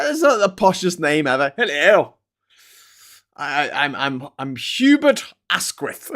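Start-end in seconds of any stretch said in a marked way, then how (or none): 1.6-6.1 put-on slow, plummy upper-class English accent; mock posh English accent. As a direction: introduce yourself as a toff in a posh English accent. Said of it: "What a posh name".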